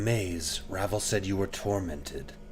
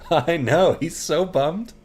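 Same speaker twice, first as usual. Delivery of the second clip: high loud giggly remark; laughing at Mort; 10 semitones higher, 11 dB louder, slower than usual. Real, not acted.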